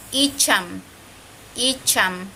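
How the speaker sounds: "icham" in two careful syllables, CH on the teeth. Shown as a demonstration. Teacher showing CH mid-word, "icham" sounded out carefully. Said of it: "es como más con los dientes".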